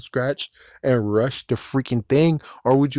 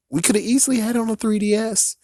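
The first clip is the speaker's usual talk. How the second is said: higher, fast, exasperated; exasperated burst; 10 semitones higher, faster than usual. Real, not acted. Vlogger exasperated that it wasn't on 3DS. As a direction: blurt it out in frustration.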